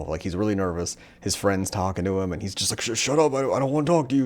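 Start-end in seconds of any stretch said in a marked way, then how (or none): 2.8-4.2 panicked. Retold or imitated. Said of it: "He's real panicked".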